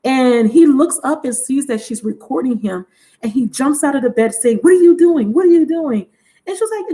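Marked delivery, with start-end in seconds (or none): 4.6-6.0 high, louder mock alarm; imitated panicked demand; startled outburst. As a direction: act out being caught off guard. Man jumps up, caught being recorded.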